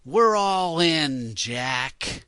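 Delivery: terrible impression, slow and loud; Jack Nicholson. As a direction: stretch it out high, falling to the name. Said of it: "you also do terrible impressions".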